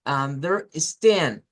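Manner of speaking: slow demo with stand stressed. Shown as a demonstration. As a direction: push the stand in Understand. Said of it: "decimos stand con más fuerza".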